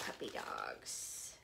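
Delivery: breathy whispered coo, slow; hushed delighted whisper. Real, not acted. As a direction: breathe it out in awe. Cooing over a puppy print.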